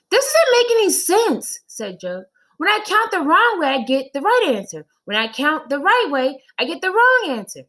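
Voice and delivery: put-on high, loud exasperated kid; a confused boy throwing up his hands; frustrated child Joe. Boy complains to his teacher, high and exasperated.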